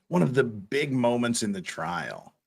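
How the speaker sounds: normal talking voice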